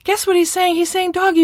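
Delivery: high-pitched, gushing mom sharing news; delighted mother. Acted. Mother phones her own mother about her son, high delighted gushing. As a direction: gush in a high voice about an adorable toddler. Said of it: "she might think it's so adorable".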